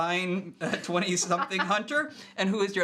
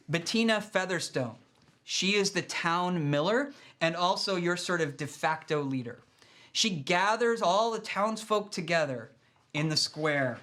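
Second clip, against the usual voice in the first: ordinary delivery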